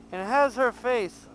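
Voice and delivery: usual speaking voice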